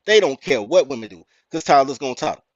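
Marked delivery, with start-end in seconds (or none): none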